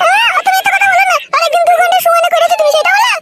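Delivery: shrill village woman. Acted. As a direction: play the wife lashing out at the girl. Female character again.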